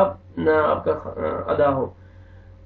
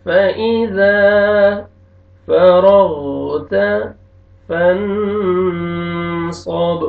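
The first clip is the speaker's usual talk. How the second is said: drawn-out chanted verse; slow Quran recitation; much slower than usual. Shown as a demonstration. Teacher recites the verse to the class. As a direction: intone slowly like a reciter.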